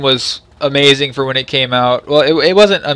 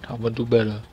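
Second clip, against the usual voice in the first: much softer, murmured to himself; quiet promise; 2 semitones lower, 9 dB quieter, faster than usual. Real, not acted. Reviewer quietly promising viewers to improve. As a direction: drop the voice and mean it under the breath.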